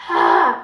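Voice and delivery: loud sharp yelp of pain; short loud ouch scream. Retold or imitated. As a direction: cry out as the piercing hurts. Needle twist pain.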